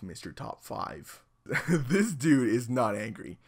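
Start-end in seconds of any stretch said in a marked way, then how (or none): 0.0-1.2 whispered, slow and very soft; soft whispered farewell; disappointed whisper. Acted. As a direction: breathe it out in quiet letdown. Reading sad comment.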